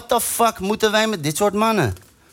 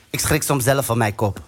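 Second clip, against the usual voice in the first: ordinary delivery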